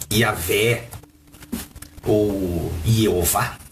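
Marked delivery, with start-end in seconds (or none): 2.9-3.6 Jeová said slowly with a Y onset; variant pronunciation. Shown as a demonstration. Teacher offers viewers another form of the name, variant pronunciation. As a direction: begin Jeová with a Y sound, drawn out. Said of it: "se você quiser falar assim".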